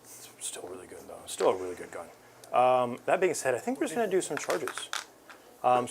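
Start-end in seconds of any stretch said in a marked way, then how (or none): 0.0-1.2 whispered, under the breath; whispered aside. Real, not acted. Whispered aside about gun.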